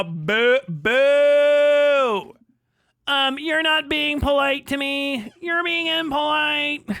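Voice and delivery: high, slow, prissy whine; mock offended prig. Streamer mocking a chat critic's whine, high and prissy. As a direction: draw it out like a pearl-clutching scold.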